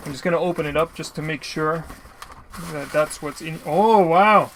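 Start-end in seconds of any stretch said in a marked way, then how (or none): 3.7-4.5 sudden burst of delighted surprise, much louder; excited wow. Real, not acted. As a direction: blurt it out, genuinely thrilled at the package. Opening parcel, finds bubble wrap.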